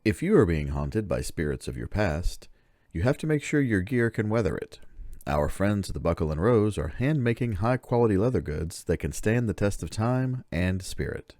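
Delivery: ordinary delivery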